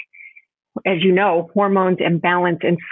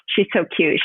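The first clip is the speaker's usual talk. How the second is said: ordinary talk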